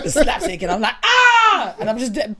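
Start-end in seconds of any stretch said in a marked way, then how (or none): 1.0-1.8 high, loud faked yelp of pain; hammed-up cry through a laugh; fake cry out. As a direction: wail as if belted, all for show.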